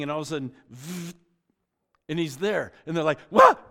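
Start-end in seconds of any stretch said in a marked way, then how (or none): 0.7-1.1 soft buzzing whoosh; imitated zap noise. Imitates sudden beaming in. 3.3-3.6 high, very loud comic shriek; startled yelp. Acted. Voices startled disciples seeing Jesus appear.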